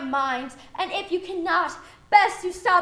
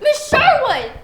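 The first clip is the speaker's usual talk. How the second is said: indignant call; 7 semitones higher, 6 dB louder than usual. Real, not acted. Student calls out indignantly.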